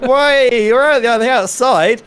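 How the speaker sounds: high, loud startled commentary; caught off guard. Real, not acted. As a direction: react in shock at the sudden move. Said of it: "That really caught me off guard there".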